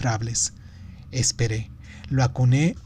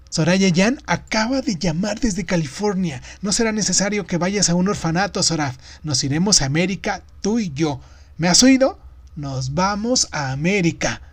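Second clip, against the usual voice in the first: higher, excited voice calling through a door; elated announcement; 6 semitones higher, 4 dB louder than usual. A narrator's reading. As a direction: share joyful news, slowing at the end. Anuncia la buena noticia. (anuncio eufórico, voz aguda)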